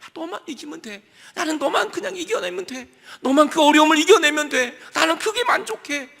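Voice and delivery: joyful Jesus. Acted. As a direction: cheer him on with exultant joy. (기뻐서 높고 크게 외치는 예수님)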